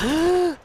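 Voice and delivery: loud high startled gasp; a sudden alarmed intake of breath; sharp gasp. Real, not acted. Sudden danger in game.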